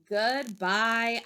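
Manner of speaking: ordinary delivery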